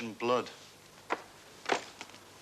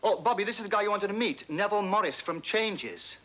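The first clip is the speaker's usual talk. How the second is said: higher, eager introduction; henchman voice; 7 semitones higher than usual. Pretend. Henchman introducing Neville to Bobby, eager and higher. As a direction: play the henchman presenting the fan. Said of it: "one of the henchmen says".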